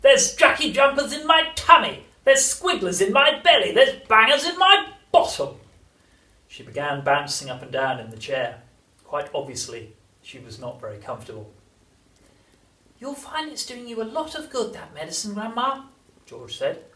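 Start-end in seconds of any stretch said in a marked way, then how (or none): 0.0-5.6 put-on high, screeching old-woman tirade; hammed-up outraged grandma in agony; indignant yell. A narrator's reading. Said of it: "she yelled". 13.0-15.9 put-on light storybook boy; George encouraging Grandma. A narrator's reading. George cheerily advising his grandmother.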